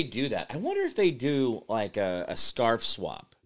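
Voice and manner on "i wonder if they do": usual speaking voice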